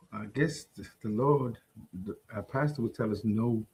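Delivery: very soft, low, hesitant answer; low mumble. Real, not acted. Answering whether believers may be hostile, low mumble. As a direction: answer reluctantly, almost under your breath, pitch dropped. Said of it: "What, what'd you say".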